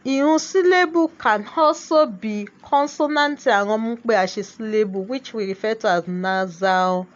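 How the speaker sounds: ordinary talk, nothing marked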